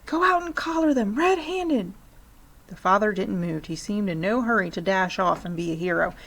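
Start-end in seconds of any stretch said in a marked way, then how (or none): 0.1-1.9 much higher, pushy wife; urging mother. A narrator's reading. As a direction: nag your husband to go.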